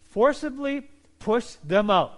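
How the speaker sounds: slow, loud, every word pushed; forceful emphasis. Shown as a demonstration. Preacher stressing the Greek meaning to the congregation, slow and loud. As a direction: drive out forcibly push them out slowly and loudly. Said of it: "The Greek translation is".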